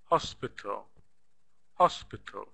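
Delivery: Hospital with the first syllable HOS stressed; Hospital, first syllable stressed, falling tune. Shown as a demonstration. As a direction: lean on the HOS in Hospital, let the rest fall. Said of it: "the first syllable is stressed here".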